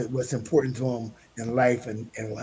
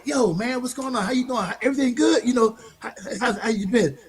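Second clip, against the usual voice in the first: overly friendly greeting; 9 semitones higher than usual. Retold or imitated. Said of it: "And I go".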